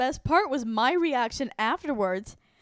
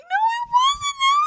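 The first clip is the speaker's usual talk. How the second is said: squeaky, much higher denial; high shaken protest; 7 dB louder than usual. Retold or imitated. Shaken guest answering the actor, squeaky denial.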